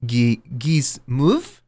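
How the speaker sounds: mock French accent, over the top. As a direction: play a cartoon Frenchman introducing himself in a French accent. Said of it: "I have a flawless French accent".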